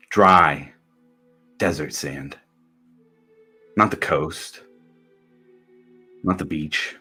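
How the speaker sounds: low, soft, sparse narration; hushed narration. A narrator's reading. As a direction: let each word fall quietly. Narrating a bleak desert arrival.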